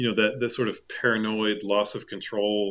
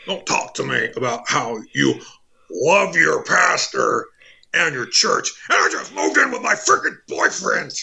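Alex Jones rant; 6 semitones higher than usual. Pretend. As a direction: spit it out like a furious radio host.

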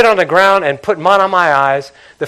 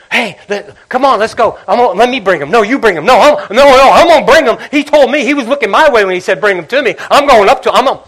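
louder, higher, jostling eagerness; eager crowd; 5 semitones higher, 7 dB louder than usual. Retold or imitated. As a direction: everyone racing to be first. Crowd scrambles to fetch him.

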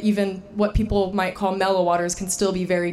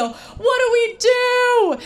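very high, slow, desperate and louder; panicked plea; 14 semitones higher, 4 dB louder, much slower than usual. Retold or imitated. To a passing paddleboarder, high desperate plea for help. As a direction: beg for help, voice shooting up. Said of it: "and I say".

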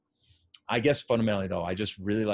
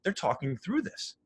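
high-pitched marveling at the players; older coach self; 3 semitones higher than usual. Retold or imitated. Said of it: "But the older me is like".

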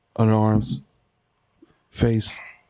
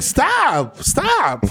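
pleading shout; 10 semitones higher than usual. Retold or imitated. Voicing [name] pleading.

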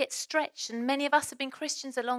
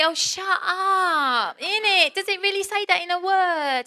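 hammed-up broad Essex, higher and louder; Essex accent; 7 semitones higher, 8 dB louder, slower than usual. Shown as a demonstration. Preacher doing a playful Essex impression, broad Essex accent. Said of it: "go a little bit more Essex than normal".

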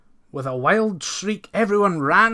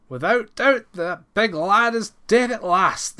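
higher and louder rejoicing; cried out; 6 semitones higher, 6 dB louder than usual. A narrator's reading. Farmhands rejoicing at lad's death, loud gleeful cry. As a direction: call it out with glee. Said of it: "they cried".

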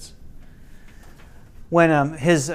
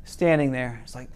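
ordinary delivery

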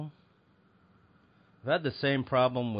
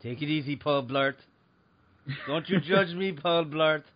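mocking shopper; 5 semitones higher than usual. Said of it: "You're like".

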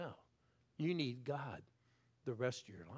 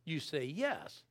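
usual speaking voice

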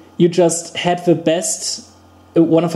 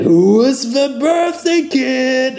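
high, slow creepy clown; 8 semitones higher, slower than usual. Retold or imitated. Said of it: "That's a good approximation of his voice".

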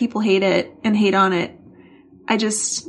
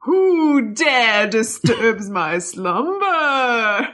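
slow, drawn-out vampire moan; outraged sleeper roused from the crypt, every word stretched; woken vampire; 3 dB louder, much slower than usual. Acted. Woken by her husband to record the podcast, groaning slow vampire. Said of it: "Who dares disturb my slumber".

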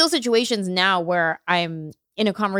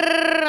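rapid Spanish babble; 10 semitones higher than usual. Retold or imitated. Imitating rapid Spanish reply.

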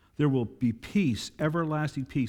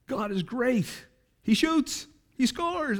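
hyped announcer call; 10 semitones higher, slower than usual. Retold or imitated. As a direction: call it like a hockey goal. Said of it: "He goes".

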